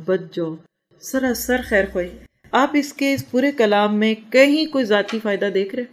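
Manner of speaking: ordinary talk, nothing marked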